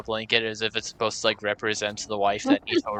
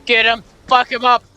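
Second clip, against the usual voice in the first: high loud fight cry; battle yell; 12 semitones higher, 7 dB louder than usual. Real, not acted. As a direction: yell to charge in.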